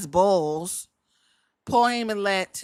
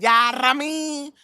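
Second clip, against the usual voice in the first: quoting Jacob; 4 semitones higher, 5 dB louder, slower than usual. Retold or imitated. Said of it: "when Jacob said".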